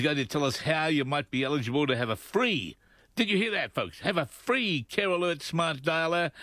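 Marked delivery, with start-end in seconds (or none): 2.2-6.3 excited sales pitch. Real, not acted. Host hypes the free offer.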